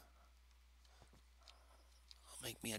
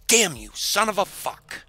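higher, much louder raging yell; furious shout; 9 semitones higher, 14 dB louder than usual. Real, not acted. Gamer raging at the enemy who nearly killed him, furious shout. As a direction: explode at the game.

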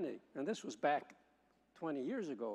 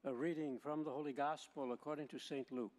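Gospel reading; 5 dB quieter than usual. Acted. Proclaims the Gospel at Mass.

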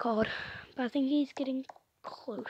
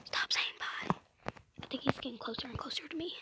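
urgent whisper while hiding; hushed; 5 dB quieter, faster than usual. Real, not acted. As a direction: whisper as the seeker closes in. Seeker is getting closer.

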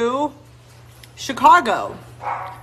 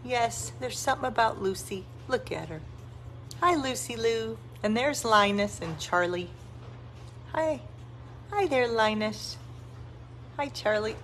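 soft murmur; 12 dB quieter than usual. Real, not acted. Woman telling tiny puppy it isn't five pounds, soft murmur. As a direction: hush down and coo to them.

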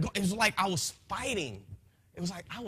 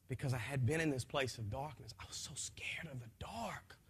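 much softer, hushed fear; fearful whisper; 5 semitones lower, 8 dB quieter than usual. Real, not acted. Man recalling his fear of the dark, whispered. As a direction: whisper it like you're still scared.

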